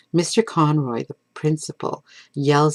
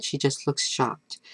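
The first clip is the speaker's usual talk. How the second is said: ordinary delivery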